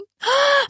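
high sharp gasp of alarm; sudden high gasp; shocked gasp. A narrator's reading. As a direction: gasp in horror. Lille sees pig in salad.

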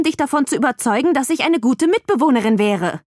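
high-pitched, insistent indignation; heated argument. A narrator's reading. Visitor demands to see the room, heated indignation. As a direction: argue back hard and press on without letting up. Heated quarrel over the viewing.

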